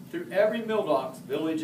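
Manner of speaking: usual speaking voice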